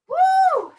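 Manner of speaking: loud, high breathless whoop; cheer. Real, not acted. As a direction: let out a big whoop. Coach cheering, tired, mid-workout.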